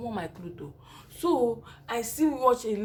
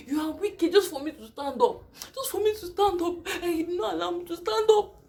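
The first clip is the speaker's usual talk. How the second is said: pleading cry; 8 semitones higher, 4 dB louder than usual. Retold or imitated. Pleading to stand in labor.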